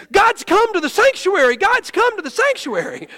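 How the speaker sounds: loud, high-pitched cry of news; imitating excited announcement. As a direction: shout it like a student bursting in with news. Students running in with news.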